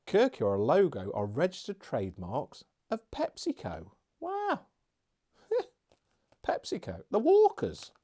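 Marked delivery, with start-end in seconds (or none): 4.2-7.9 high laughing surprise; amused surprise. Real, not acted. Reviewer finding out the snack is made by PepsiCo, squeaking.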